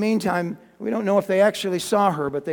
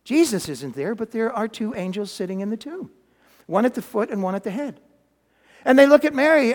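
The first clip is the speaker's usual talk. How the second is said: normal talking voice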